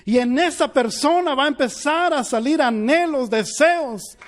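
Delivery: impassioned sermon climax; fervent preaching. Acted. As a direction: preach it to the congregation with fire. Preaching at full force.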